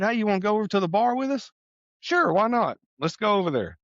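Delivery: high breezy mock invitation; cheerful colleagues inviting him to the bar; mock eager. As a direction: toss it off lightly, like no big deal. Coworkers invite, he agrees.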